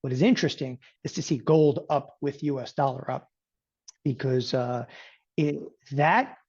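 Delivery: ordinary delivery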